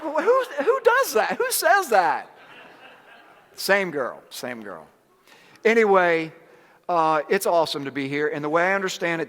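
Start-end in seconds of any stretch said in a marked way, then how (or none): 0.0-2.3 high, loud, laughing mock outrage; mock disbelief. Retelling daughter's jab to the crowd, high incredulous laugh. 4.3-4.9 dropped, softer and lower repeat; quiet aside. Shown as a demonstration. Echoing the punchline to the crowd, quiet and low.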